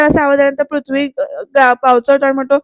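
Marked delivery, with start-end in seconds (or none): none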